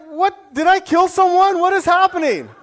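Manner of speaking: high, loud, incredulous outburst; a stunned man blurting in court; shocked disbelief. Retold or imitated. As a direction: yelp it out like you can't believe your ears. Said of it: "I'm like".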